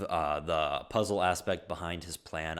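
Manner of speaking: ordinary delivery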